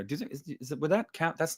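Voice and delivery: ordinary delivery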